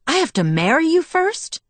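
high, rising incredulous question; disbelief. A narrator's reading. Bella reacts to Edward's marriage condition in disbelief. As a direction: leap up in pitch, unable to believe it. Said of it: "I asked in disbelief".

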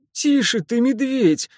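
wheezing, squeezed in a bear hug; breathless wheeze. Acted. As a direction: gasp it out, nearly choking. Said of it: "чуть не задыхаясь, прохрипел Стас".

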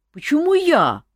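high, loud offended protest. A narrator's reading. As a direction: squeal out the injustice. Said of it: "обиделся Жак".

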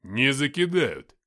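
loud, slow gruff bark; barked retort. A narrator's reading. Comrade dismisses the grenade fear, barked retort. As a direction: bark back a firm denial, drawing it out. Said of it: "рявкнул Филиппыч".

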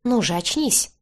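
mildly insistent call. A narrator's reading. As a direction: coax a friend to come round. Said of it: "позвал жёлтоглазый шатен".

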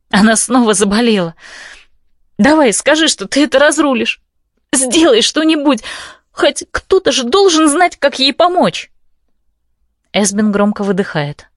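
high frantic pleading; frantic begging. Acted. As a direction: beg desperately, high pitched. Heroine begs frantically.

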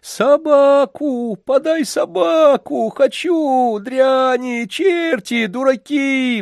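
shrieking, very high tantrum; raging spoilt child. A narrator's reading. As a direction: scream furiously at everyone. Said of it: "выходил из себя мальчик".

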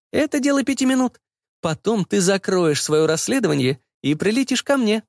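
high-pitched, insistent mother; bossy mother. Acted. Mother telling son how to solve his case, bossy.

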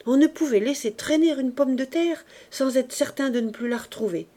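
ordinary delivery

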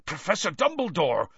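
high, astonished call; startled Hagrid. A narrator's reading. Hagrid arriving to find Dumbledore, startled. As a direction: blurt it out in surprise. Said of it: "his eyes widening".

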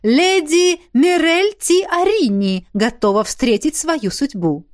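high, loud, slow ceremonial proclamation; loud announcement. A narrator's reading. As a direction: announce it to the whole garden. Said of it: "Даррелл громко объявил". (громко и торжественно объявляя)

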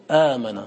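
آمن with a long drawn aa at the start; long initial vowel. Shown as a demonstration. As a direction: stretch the first vowel of آمن into aamana. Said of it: "تدخل عليه الهمزة في الأول فتقول".

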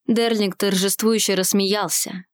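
plain reading voice

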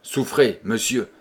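ordinary delivery, nothing marked

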